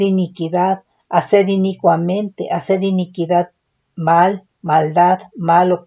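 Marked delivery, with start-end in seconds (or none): none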